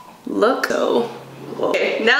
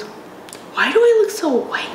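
dismayed; 10 semitones higher, slower than usual. Real, not acted. Dismayed at pale face.

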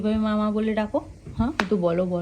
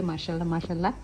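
usual speaking voice

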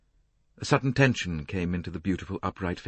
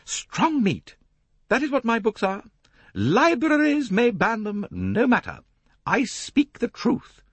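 much higher and slow, insisting; hammering home her creed; emphatic; 5 dB louder than usual. A narrator's reading. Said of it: "wagging the turban emphatically".

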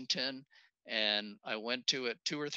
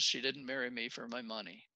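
usual speaking voice

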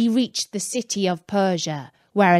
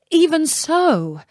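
Aladdin voice; 7 semitones higher, slower than usual. Acted. Aladdin answers genie.

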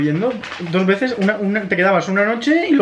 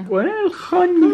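blubbering; 10 semitones higher, slower than usual. Pretend. Tearful over old photo.

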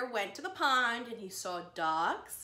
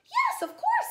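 much higher, bright friendly giraffe voice; Daisy's voice; 8 dB louder than usual. Acted. Daisy agrees to go, high cheerful character voice. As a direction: pop the answer up high and cheery.

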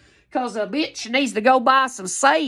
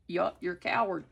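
normal talking voice

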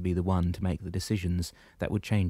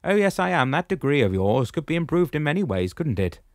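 higher, louder commanding shepherd voice; booming old shepherd, pitched up; 8 semitones higher, 6 dB louder than usual. Acted. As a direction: address him firmly from above. Damianos speaks.

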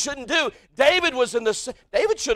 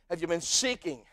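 ordinary delivery

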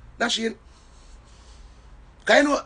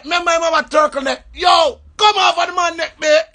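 high, loud plea; shouting at cop; 8 semitones higher, 7 dB louder than usual. Retold or imitated. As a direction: yell at him to get off the neck. Shouting at officer again.

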